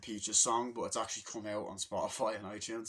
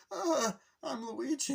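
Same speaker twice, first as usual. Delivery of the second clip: high, slow nervous Luigi squeak; timid Luigi; 14 semitones higher, slower than usual. Retold or imitated. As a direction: squeak it out like a scared little brother.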